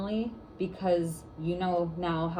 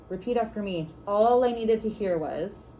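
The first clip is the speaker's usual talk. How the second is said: louder, slow call for the group to echo; second try, calling it out slower and louder; 1 call and response lead, slow and louder; 4 semitones higher, 4 dB louder than usual. Shown as a demonstration. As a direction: project the line so the room repeats it. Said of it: "Let's try it again".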